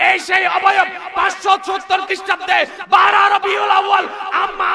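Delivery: high-pitched, sustained impassioned preaching; full-force preaching. Real, not acted. Preacher reveals the Prophet's form to the crowd, full-force preaching. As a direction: drive the sermon to a shouted climax.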